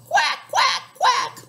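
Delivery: high loud quacking; duck call. Acted. Papa Duck calling his ducklings, high quacks. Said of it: "Papa Duck called".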